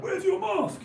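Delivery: mocking nag. Mocking people who nag him about masks, high whiny mock. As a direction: squeak it out like a nosy busybody.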